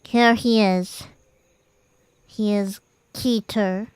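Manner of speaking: usual speaking voice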